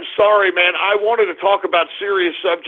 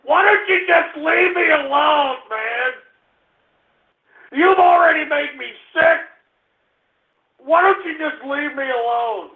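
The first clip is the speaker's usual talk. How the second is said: real crying whine, much higher; whiny plea; 6 semitones higher than usual. Real, not acted. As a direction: beg to be left alone, near tears. Distressed plea to trolls.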